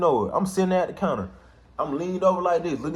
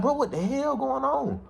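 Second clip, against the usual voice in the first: much higher, slow and disbelieving; stunned; 14 semitones higher, 3 dB quieter, slower than usual. Retold or imitated. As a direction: drag it out in disbelief. Walking out, sees guns on car.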